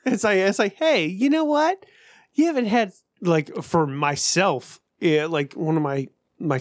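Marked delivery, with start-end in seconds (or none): 0.8-2.9 needling brain voice. Retold or imitated. His brain tempting him with snacks, high mock needling. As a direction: pitch it up like a pestering inner voice. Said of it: "My brain goes asshole mode".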